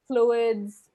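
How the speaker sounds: ordinary delivery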